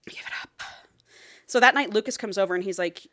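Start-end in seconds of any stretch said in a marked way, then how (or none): 0.1-0.6 unvoiced, hushed whisper. Real, not acted. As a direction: whisper it conspiratorially. Whispered aside.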